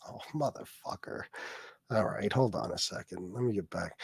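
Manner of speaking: ordinary talk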